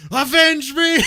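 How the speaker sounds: high, loud shouted last words; hammed-up dying shout; overacted death scene. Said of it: "he just kinda spouts up with energy".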